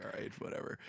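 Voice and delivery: unvoiced dismissive whisper. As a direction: whisper it with a shrug. Dismissive retold reaction.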